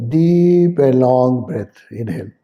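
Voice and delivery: lingering breathing cue. Shown as a demonstration. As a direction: draw each word out to the length of a breath. Models a long breath while guiding.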